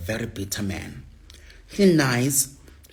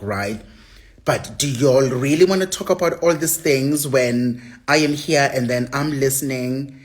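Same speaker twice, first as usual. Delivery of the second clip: raised, incredulous retelling; retold challenge; 4 semitones higher, 6 dB louder than usual. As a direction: loudly ask if they're sure. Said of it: "then I asked him, I'm like".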